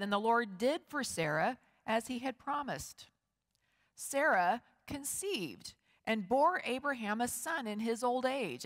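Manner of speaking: usual speaking voice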